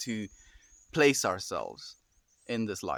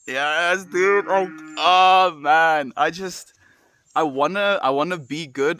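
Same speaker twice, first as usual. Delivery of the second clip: loud, higher burst of exasperation; frustrated outburst; 5 semitones higher, 7 dB louder, slower than usual. Real, not acted. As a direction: let it burst out, fed up with being a beginner. Frustrated outburst about drawing skill.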